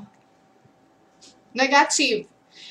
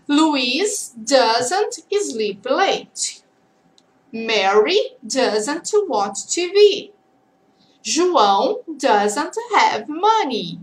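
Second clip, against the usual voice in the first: each example sentence drawn out word by word; very slow model sentences; much slower than usual. Shown as a demonstration. Teacher reading example sentences very slowly to the class. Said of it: "aqui eu falo assim".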